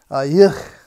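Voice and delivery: loud, emphatic disgust on "yuck". Acted. Little princess complains about her nappies, loud disgust.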